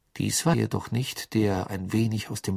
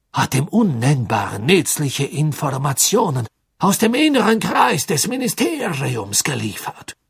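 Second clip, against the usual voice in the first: eager prisoner; 4 semitones higher, 5 dB louder than usual. A narrator's reading. Prisoner naming names to save himself.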